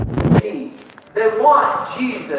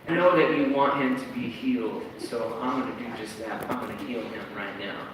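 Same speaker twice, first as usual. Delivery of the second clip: soft, lower, unhurried Jesus voice; gentle Jesus; 6 semitones lower, 7 dB quieter than usual. Retold or imitated. Jesus gently telling the friends he sees their faith, soft. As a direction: reassure them quietly. Voicing Jesus imagined reply.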